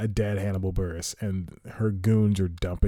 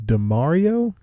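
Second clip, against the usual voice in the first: real surprise; 8 semitones higher, 8 dB louder, slower than usual. Real, not acted. Shocked by the Bachelorette on TV.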